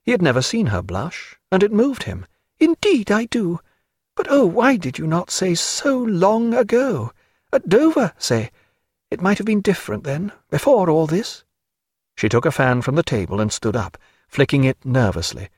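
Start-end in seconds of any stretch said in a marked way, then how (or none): 2.6-11.4 high woman's voice, earnest reproach; regretful Diana. Acted. Diana asking Stephen why he waited, high and regretful. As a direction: plead gently in a high feminine voice.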